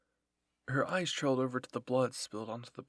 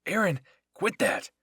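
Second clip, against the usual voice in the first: high, loud urgent shout; shouted order; 7 semitones higher, 13 dB louder than usual. A narrator's reading. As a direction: yell at him to stop. Shouted order to attacking fighter.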